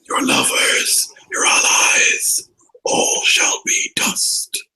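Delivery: very slow, unvoiced whisper of doom; sinister whisper. Game master as evil voice threatening Cynric, slow sinister whisper. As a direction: whisper each threat, long and drawn out. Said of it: "You can hear the voice as well".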